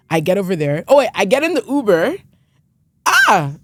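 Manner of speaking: ordinary talk, nothing marked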